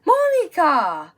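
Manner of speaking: loud, high call of a student's name; teacher yelling a name. Retold or imitated. Teacher yelling a student's name across the class, loud and slow. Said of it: "she was yelling at everybody".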